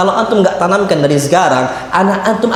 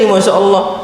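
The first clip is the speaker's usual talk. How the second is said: ordinary talk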